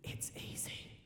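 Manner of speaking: hushed, coaxing whisper; very soft, voiceless whisper. Retold or imitated. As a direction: whisper it right into an ear. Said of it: "Dad just whispers in my ear".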